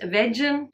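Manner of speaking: "vegan" as VEJ-un, like "veggie"; "vegan" with the start of "veggies"; mispronounced vegan. Shown as a demonstration. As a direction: say "vegan" with a soft j and short e. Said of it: "isn't pronounced vegan".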